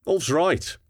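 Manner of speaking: eager, pitch leaping up on Ulf's; energetic. A narrator's reading. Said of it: "Bader said energetically".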